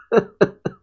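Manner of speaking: short high chuckle; laugh. Real, not acted. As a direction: let out a brief, high laugh. Laughs at what he reads.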